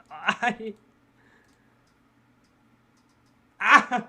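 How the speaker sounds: high stammer bursting into a loud cry; anguished scream. Acted. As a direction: choke on the word, then scream. Guilt-stricken character breaks into scream.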